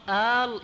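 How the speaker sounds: آل with a long held "aa"; prolonged alif on آل; stretched hamza. Shown as a demonstration. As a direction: hold the opening vowel of آل. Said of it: "يمدها".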